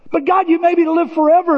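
high, fast complaining plea; whiny plea. Retold or imitated. Pastor voicing his own complaint to God, high and whiny. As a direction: protest to God like a child.

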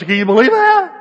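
very loud, high-pitched outburst; hammed-up squeal of astonishment; amazed disbelief. Mark to his friend about the wrenches, flabbergasted.

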